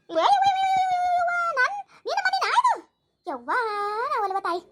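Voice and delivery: high-pitched theatrical wail to mother; loud wailing. Acted. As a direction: wail out in protest, dropping at the end. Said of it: "ಹಂಗ್ಯಾಕ್ ಹೊಯ್ಕೋತಿ". (ಗೋಳಾಡುತ್ತಾ ಎತ್ತರದ ದನಿಯಲ್ಲಿ ಅಳು)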